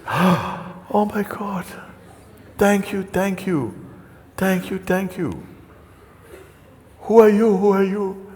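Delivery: gasping, high-pitched grateful gush; hammed-up thanks to an unseen rescuer; overjoyed relief, acted. Rescued man answers the voice.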